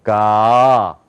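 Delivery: low, long "가" for students to copy; American "가", slowly drawn out; American-style 가. Shown as a demonstration. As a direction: swell "가" open from the throat. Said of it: "저하고 미국식으로".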